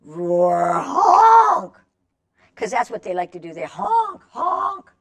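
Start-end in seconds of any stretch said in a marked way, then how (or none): 0.0-1.8 silly goose trying to roar; roar honk. Acted. 3.6-4.9 high honking goose call; honking goose for kids. Shown as a demonstration. Demonstrating goose honk.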